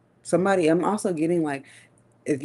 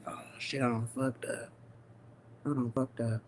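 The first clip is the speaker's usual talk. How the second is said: hushed, low retold realization; mock dawning regret; 5 semitones lower, 13 dB quieter than usual. People realizing her silence means trouble, in hushed mock regret. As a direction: act out a quiet, low "uh-oh" under the breath.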